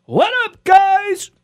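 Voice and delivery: high-pitched mock greeting, drawn high; Bama Grill Master. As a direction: imitate a hyped grill-video host's catchphrase. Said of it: "I'm tired of seeing this guy going".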